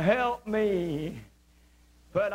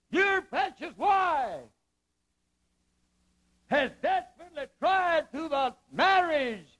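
voice of God; 8 semitones higher than usual. Acted. God rebuking the husband, lightly voiced stern God. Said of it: "I believe God was saying".

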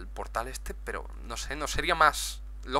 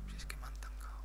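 breathy muttered whisper; 18 dB quieter, faster than usual. Real, not acted. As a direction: whisper it to yourself. Repeats the complaint twice.

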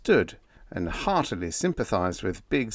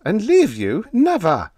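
indignant refusal; 11 semitones higher, 7 dB louder than usual. A narrator's reading. Husband refusing to leave wife.

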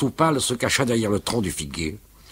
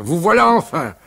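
loud, high, hearty teasing; 8 semitones higher, 5 dB louder than usual. Acted. Uncle Jules teasing the late father, loud and hearty. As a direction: boom it out jovially. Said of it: "Il parlait d'une voix forte".

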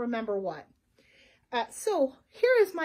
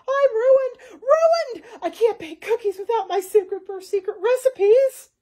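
high, loud panicked shouting; frantic wailing; 9 semitones higher, 10 dB louder, slower than usual. Acted. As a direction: wail it out, going completely nuts. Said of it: "Coco Dodo went nuts".

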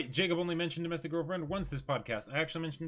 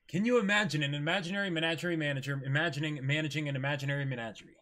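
rapid tongue twister attempt; fast tongue twister; faster than usual. Shown as a demonstration. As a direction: rattle it off quickly. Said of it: "[name], go for it".

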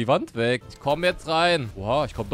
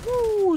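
high power-up whoop. Real, not acted. Power-up exclamation.

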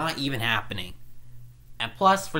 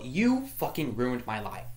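ordinary talk, nothing marked

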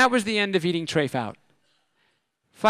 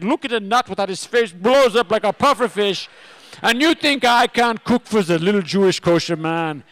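put-on German accent, booming with wounded pride; ranting German chef; 6 semitones higher, 9 dB louder than usual. Chef ranting at the kosher comedian, loud German accent. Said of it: "the big German chef".

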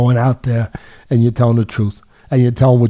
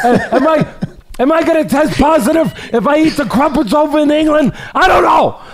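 high, teasing mock-innocent rising to shouts; joking mock suspicion. Teasing British fans about the crumpets, high mock-innocent jokey voice. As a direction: playfully needle the fans, voice pitched way up. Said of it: "I'm just kidding".

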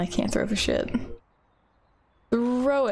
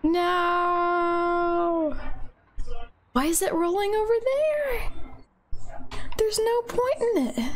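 panicked high whine; 9 semitones higher, slower than usual. Real, not acted. Ball rolls away, panicked yelp.

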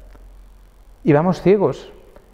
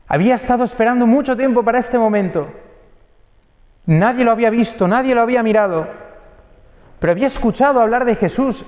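higher, urgent preaching build; impassioned preaching; 6 semitones higher than usual. Acted. Preacher telling the congregation of the blind beggar, impassioned. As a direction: lift the congregation with rising urgency.

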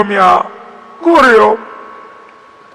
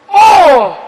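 pained yelp; 9 semitones higher, 5 dB louder than usual. Real, not acted. Hit in the game, yelps.

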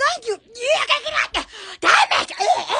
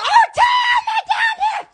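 much higher, thrilled cry; excited exclaim; 11 semitones higher than usual. Real, not acted. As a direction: yelp it out as you spot them. Spots the find, exclaims.

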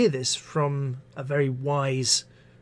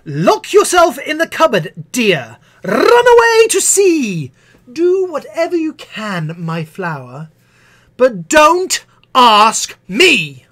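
much higher and louder, slowing at the end; teacher snapping; 9 semitones higher, 12 dB louder, slower than usual. Acted. Missus Butler finally blowing up at the pupil, shouted exasperation. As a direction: lose it completely at the child.

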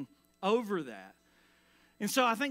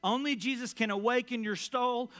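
ordinary talk

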